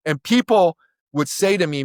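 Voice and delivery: ordinary delivery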